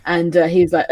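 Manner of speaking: ordinary talk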